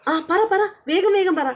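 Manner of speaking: impatient, high and eager; eager urging. Retold or imitated. Husband urging his wife to speak, eager. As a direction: hurry her to spill it.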